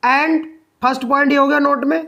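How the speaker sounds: normal talking voice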